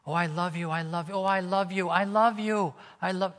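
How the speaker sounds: high, syrupy, overdone I love you, rising as it goes; mock gushing love. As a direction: ham up the empty sweet talk. Mocking empty words of love.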